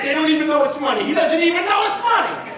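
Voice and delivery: loud, crowing delight; imitated father's loud excitement; excited. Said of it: "and he's excited".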